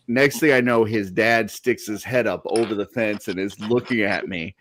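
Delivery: ordinary talk, nothing marked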